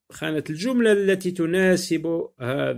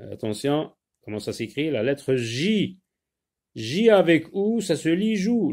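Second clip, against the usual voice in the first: ordinary talk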